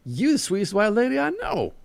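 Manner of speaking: very high, effusive flattery; lavish praise in a high character voice; gushing old woman. A narrator's reading.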